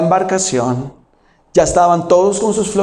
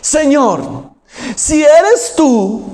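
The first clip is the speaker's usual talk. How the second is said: agudo y muy lento, inseguro; Pedro dudando; 8 semitones higher, much slower than usual. Retold or imitated. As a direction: suena a Pedro que duda. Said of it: "Pedro le respondió". (Pedro inseguro, agudo y lento)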